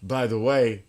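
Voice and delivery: put-on tossing off a casual remark in a mild English accent; slightly put-on English accent, half-hearted. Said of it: "in his English accent".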